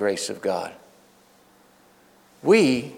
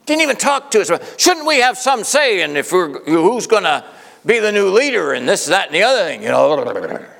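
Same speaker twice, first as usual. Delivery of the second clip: high, loud, indignant outburst; a wronged youth president venting; indignant teen rant; 7 semitones higher, 4 dB louder than usual. Retold or imitated. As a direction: rant like an offended teenager. Teen ranting to friends after service.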